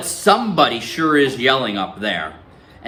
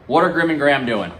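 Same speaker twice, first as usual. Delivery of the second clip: ordinary delivery, nothing marked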